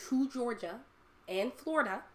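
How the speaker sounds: normal talking voice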